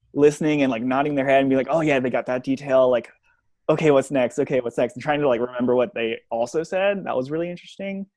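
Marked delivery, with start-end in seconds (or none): none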